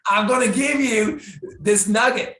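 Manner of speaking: high, loud eager tease; excited build-up. Real, not acted. To the host, hyping the big nugget, high and loud. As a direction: lean in and hype the secret.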